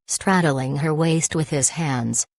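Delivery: usual speaking voice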